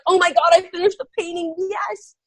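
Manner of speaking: high, fast thrilled burst; retold excited relief. Telling the interviewer her thrilled relief on finishing, high. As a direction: relive finishing just in time.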